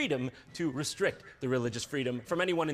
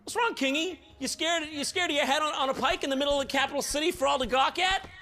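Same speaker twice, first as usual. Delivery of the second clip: mocking taunt; 8 semitones higher than usual. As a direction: taunt him like a schoolyard bully. Taunting the king.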